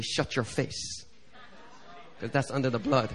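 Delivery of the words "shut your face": breathed, slow brush-off; a slow, whispered "shut your face"; hushed dismissal. Pretend. Dismissing the devil's reminders.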